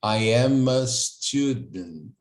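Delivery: slow I am a student, "am" linked smoothly; native linking. Shown as a demonstration. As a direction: link "am" like a native, slowly. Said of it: "É assim que o nativo fala". ("am" emendado como nativo, devagar)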